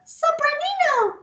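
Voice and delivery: teacher showing the highest pitch; high voice for sopranino. Shown as a demonstration. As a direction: squeak it up high like the littlest pipe. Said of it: "it's the highest 'cause it's the littlest".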